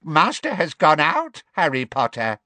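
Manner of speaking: high, loud elf voice, dropping at the end; gloating house elf. A narrator's reading. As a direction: taunt him as a gleeful servant. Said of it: "gave a wheezy chuckle".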